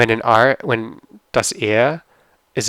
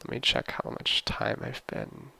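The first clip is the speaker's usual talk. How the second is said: whispered aside; 10 semitones lower, 11 dB quieter than usual. Real, not acted. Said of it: "aside to himself".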